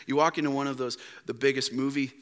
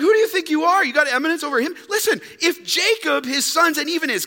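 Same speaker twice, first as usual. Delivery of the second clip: high, loud incredulous challenge; scoffing woman; 10 semitones higher, 4 dB louder, faster than usual. Acted. Woman at the well scoffing at the stranger. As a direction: throw it back at him. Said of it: "She's like".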